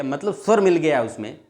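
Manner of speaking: normal talking voice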